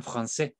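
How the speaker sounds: français in French, the ç sounded as S; cedilla as S. Shown as a demonstration. Teacher reads a French example, français with soft S. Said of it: "es como una S simplemente".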